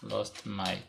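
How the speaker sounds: slow, soft, every word drawn out; slow dictation. Shown as a demonstration. Teacher writing the example on the board, slow soft dictation. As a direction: read it out slowly as you write it.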